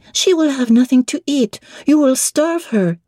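put-on high child voice asking in French; little French girl. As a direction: ask it like an eager little girl. Adele objects.

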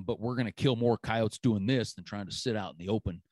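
ordinary talk, nothing marked

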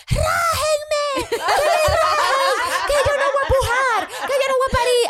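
high, loud half-laughing mock shriek; crazy woman yelling. Woman in the street yelling to be cut open. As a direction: ham up a hysterical woman in labor. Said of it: "una loca diciendo".

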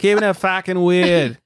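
exaggerated Boston accent. Repeats line in exaggerated Boston accent.

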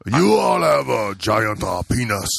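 slow, drawn-out mock Japanese accent. Shown as a demonstration. Host demonstrating his version, slow mock Japanese accent. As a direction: exaggerate a Japanese accent, stretching each word. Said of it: "I do it like that".

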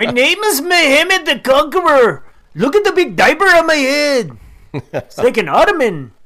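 loud high pompous sultan. Pretend. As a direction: proclaim like a grand sultan. Voicing the sultan picture.

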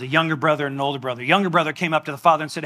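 ordinary delivery

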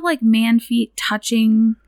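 ordinary talk

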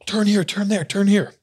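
panicked passenger calling turns; urgent directions. Retold or imitated. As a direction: bark turns at the driver in a panic. Dad frantically directing driver.

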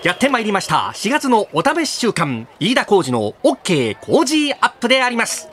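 bright, punchy radio host intro; energetic show opening. Acted. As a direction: kick off the show with a bang.

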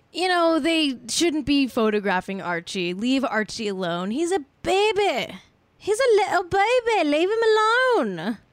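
high, posh-ish British accent put on; mock British plea to leave the baby alone. Host doing a British accent for the royals. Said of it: "That's my British accent".

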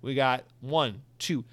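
ordinary talk

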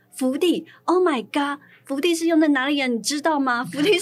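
high, loud disbelief; acted-out inner protest, emphatic and aghast; incredulous outburst. Employee silently protesting the boss's claim, loudly incredulous.

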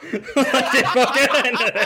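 laughing. Real, not acted.

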